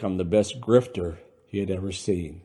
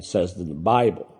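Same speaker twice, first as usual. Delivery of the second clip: ordinary delivery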